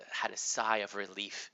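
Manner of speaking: ordinary delivery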